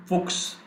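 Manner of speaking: "Fuchs" said as "fooks"; "Fuchs" alone, as a word to copy; chs as ks. Shown as a demonstration. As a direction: model "Fuchs" ending in ks. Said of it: "going to be pronounced as x".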